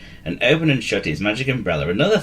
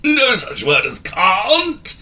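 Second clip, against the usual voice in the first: high, louder and slow; cry out; 12 semitones higher, 5 dB louder, much slower than usual. A narrator's reading. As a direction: insist firmly, wizard style. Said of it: "he cried".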